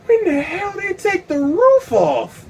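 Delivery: slow, very high-pitched amazement; awestruck disbelief. Acted. Tripping man notices the roof is gone, high stunned disbelief.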